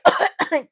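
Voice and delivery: mock coughing fit; imitated coughing. Fan retelling singing too hard, imitated cough. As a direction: cough as if choked mid-song.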